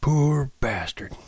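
slow, soft and pitying; Candy over the dead girl, soft and slow; softly. A narrator's reading. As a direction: breathe it over the body. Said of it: "he said softly".

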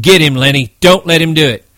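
loud urging shout; urgent shout. Acted. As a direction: call across the room to Lenny. George urging Lenny.

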